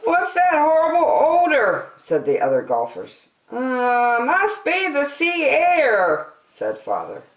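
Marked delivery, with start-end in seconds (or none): 0.0-1.9 high, loud disgusted golfer voice. A narrator's reading. Golfers complaining about smell. 3.5-6.3 high, slow sheepish excuse; Father's excuse. A narrator's reading.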